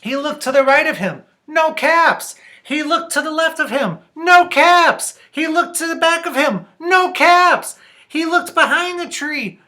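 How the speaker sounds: high, loud, quick read-aloud; excited story narration. A narrator's reading. Reader tells children of the peddler's search, excited narration. Reads the frantic search for caps.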